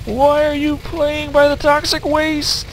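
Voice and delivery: high slow call. Real, not acted. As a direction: call out to someone far off. Calling to character.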